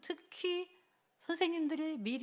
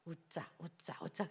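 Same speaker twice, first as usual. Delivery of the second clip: murmured to herself, much softer; softly repeated reminder to smile; quiet self pep talk; 5 semitones lower, 8 dB quieter than usual. Retold or imitated. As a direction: psych yourself up under your breath. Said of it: "그랬대요".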